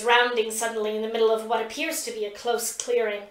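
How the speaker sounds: usual speaking voice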